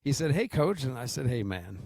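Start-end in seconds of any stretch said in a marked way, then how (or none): none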